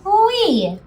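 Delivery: high little wee; a light swoop up on wee; playful wee. Shown as a demonstration. Handwriting chant, playful dive.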